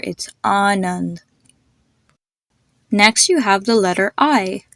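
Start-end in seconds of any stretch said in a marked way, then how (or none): 0.4-1.2 "Anand" slowly, ah first, uh second; AH-nund. Shown as a demonstration. Teacher putting both sounds together, slow "Anand" as AH-nund. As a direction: draw out "Anand" with car's A, then fun's A. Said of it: "So put together".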